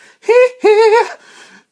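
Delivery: a very high, loud hee-hee; falsetto giggle. Pretend. [name] impression laugh.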